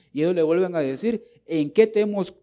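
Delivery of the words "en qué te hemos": louder, higher mock challenge; disrespectful priests. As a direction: act out a priest answering back. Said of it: "los sacerdotes irrespetuosos y le dicen". (réplica irrespetuosa, en voz más alta)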